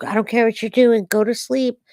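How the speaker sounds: usual speaking voice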